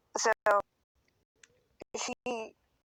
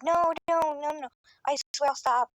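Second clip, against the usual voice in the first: frantic pleading; 7 semitones higher than usual. Retold or imitated. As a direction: imitate a friend hurriedly promising to stop. Friend frantically promising to stop.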